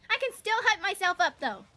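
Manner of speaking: high, loud hyped-up yell; yelling tennis girl. Acted. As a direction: pump yourself up at full volume. Voicing Tenko hyping herself up.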